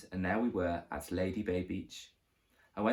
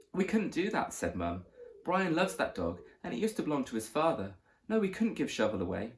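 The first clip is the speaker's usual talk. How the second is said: higher, earnest mother defending her son; mother pleading with the landlady, raised voice; pleading mum; 6 semitones higher than usual. A narrator's reading. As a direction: beg the landlady to let you keep the dog. Mum pleads to keep the dog.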